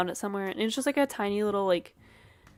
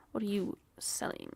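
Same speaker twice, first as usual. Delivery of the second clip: whispered question; 6 dB quieter than usual. Real, not acted. Reading shop quietly.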